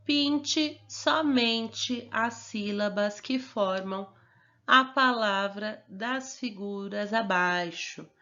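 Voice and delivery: reading the task aloud slowly and clearly; slow instruction reading. Shown as a demonstration. Teacher reading the exercise instruction slowly aloud. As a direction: spell out the instruction slowly for the class. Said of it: "que fala assim".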